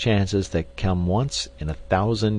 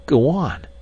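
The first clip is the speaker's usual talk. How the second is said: urging; 8 semitones higher, 4 dB louder than usual. A narrator's reading. Cavor urges him on.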